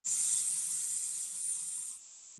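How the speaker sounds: "S" as a long quiet unvoiced hiss. Shown as a demonstration. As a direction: hiss "S" through front teeth. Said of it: "smile, teeth together, tongue behind your teeth".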